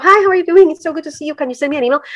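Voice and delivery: butter them up before the ask; mock sugary politeness before a request; sugarcoated sweetness. Shown as a demonstration. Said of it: "Do you want me to sugarcoat?".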